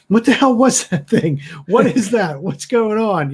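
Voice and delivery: shouting. Acted. Arthur running after Johnny, shouting about the creature. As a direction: shout questions after someone ahead of you. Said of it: "gonna be shouting at Johnny".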